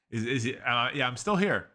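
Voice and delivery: ordinary talk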